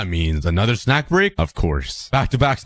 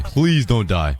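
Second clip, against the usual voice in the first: ordinary talk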